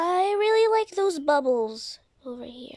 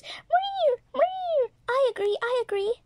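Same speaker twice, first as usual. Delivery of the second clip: cat voice; 10 semitones higher, 5 dB louder than usual. Acted. Cat toy agrees eagerly.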